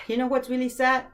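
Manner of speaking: ordinary talk, nothing marked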